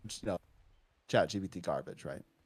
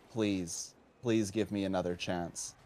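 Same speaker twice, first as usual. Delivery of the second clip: softer, lower retold plea; subdued pleading; 4 semitones lower, 5 dB quieter, slower than usual. Student begging teacher for another chance, subdued pleading. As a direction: beg quietly, low and softened.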